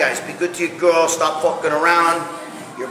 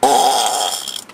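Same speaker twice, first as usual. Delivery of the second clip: long, low toilet groan; imitated straining grunt; 9 semitones lower than usual. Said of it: "another one".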